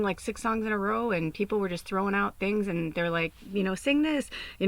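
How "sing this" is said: crowd calling out. Retold or imitated.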